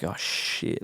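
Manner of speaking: whispered, sinking realisation; retold dread. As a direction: breathe the swear out under the voice. Retells dread before the verdict.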